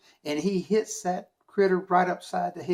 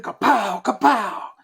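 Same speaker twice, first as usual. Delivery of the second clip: loud, higher double kapow; kick sound effects; 5 semitones higher, 9 dB louder, slower than usual. A narrator's reading. Storyteller acting out Brer Rabbit's double kick, loud. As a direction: hit each one like a kick. Two kicks.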